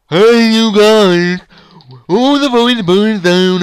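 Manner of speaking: loud, high, drawn-out parody; mock silly voice. Shown as a demonstration. Brother showing how he doesn't sound, silly voice. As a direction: belt it out as the bad example. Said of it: "not like".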